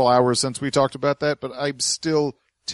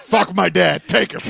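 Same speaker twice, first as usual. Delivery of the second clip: mock Alex Jones rant; 5 semitones higher, 5 dB louder than usual. Mocking Alex Jones's clip.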